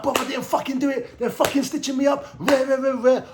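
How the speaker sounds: high, sing-song complaint to fellow inmates; animated protest. Retold or imitated. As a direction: wind yourself up telling everyone it wasn't you. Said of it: "I'm animated in prison".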